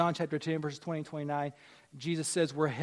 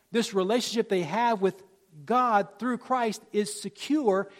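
raised, higher preaching voice building to loud; forceful preaching; 6 semitones higher, 9 dB louder than usual. Real, not acted. Preacher assuring congregation they are secure, building forcefully. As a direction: drive the point home, building in volume.

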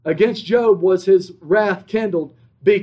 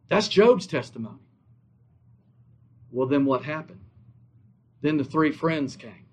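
usual speaking voice

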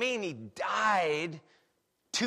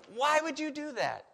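high, pointed indignant question; indignant why; 9 semitones higher than usual. Retold or imitated. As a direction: ask why as if shocked. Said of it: "I would expect Paul to argue that way".

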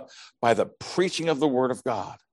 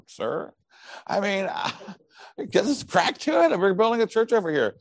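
high, strained mock protest; Peter; 10 semitones higher than usual. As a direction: play an over-eager disciple, voice pitched way up. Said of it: "Peter goes to him".

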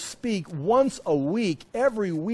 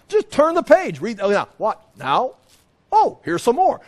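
high, loud, mock-naive page turner; mock simple reader; 4 semitones higher, 5 dB louder than usual. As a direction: act out the obvious, with sarcasm. Mimics simple reading of Bible.

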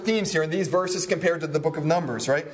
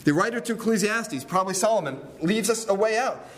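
ordinary talk

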